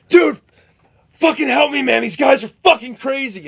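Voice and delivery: high, loud frantic plea for help; panicked yell. Retold or imitated. Mike begging the pizza kid to save him, panicked yell. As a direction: yell it like you're being dragged off. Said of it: "Be like".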